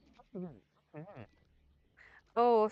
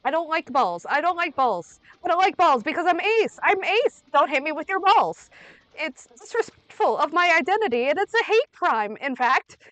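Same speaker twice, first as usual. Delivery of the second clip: loud, raised-pitch outburst of mock outrage; indignant rant; 5 semitones higher, 8 dB louder than usual. Real, not acted. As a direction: protest loudly, hammering each sentence.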